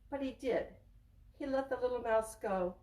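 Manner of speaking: ordinary talk, nothing marked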